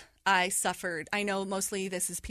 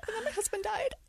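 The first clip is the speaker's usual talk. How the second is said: says it through a high, wry laugh; 13 semitones higher, 4 dB quieter than usual. Real, not acted. Laughing in disbelief as she tells the hosts.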